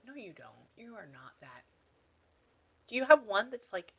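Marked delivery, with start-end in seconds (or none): 0.1-1.6 very soft disbelieving murmur; murmured aside. Real, not acted. Medium doubting what the spirit tells her, murmured aside. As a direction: mutter it to yourself, trailing lower.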